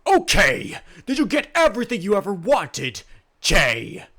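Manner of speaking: big earnest himbo, loud and proud; dopey sweet himbo. Acted. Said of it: "Bang himself is like".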